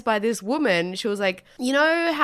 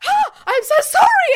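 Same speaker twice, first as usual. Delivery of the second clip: panicked apology; 8 dB louder than usual. Retold or imitated. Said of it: "Or they're like".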